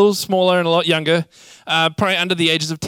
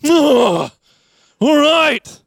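put-on loud, much higher exasperated kid groan; childish humph; 10 semitones higher, 6 dB louder, much slower than usual. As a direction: huff it out like a sulky preschooler. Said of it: "biggest humph any small child could muster".

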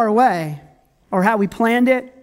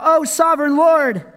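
raised, higher cry to the Lord; 6 semitones higher than usual. A narrator's reading. Joshua calls to the Lord in defeat, crying out. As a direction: read it as an anguished call. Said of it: "Then Joshua cried out".

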